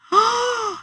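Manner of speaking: sharp, high gasp; shocked gasp. Retold or imitated. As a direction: gasp at a startling sight. Acting out shock at door.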